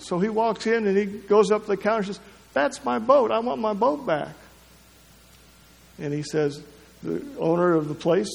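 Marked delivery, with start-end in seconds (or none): 2.5-4.3 put-on insistent little boy. Boy voiced claiming his boat.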